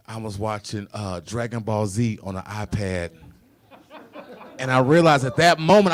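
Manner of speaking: ordinary talk, nothing marked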